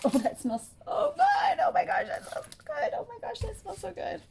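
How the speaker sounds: rapturous high swooning over the smell; savoring a smell. Real, not acted. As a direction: breathe in the scent and swoon.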